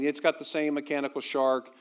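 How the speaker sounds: usual speaking voice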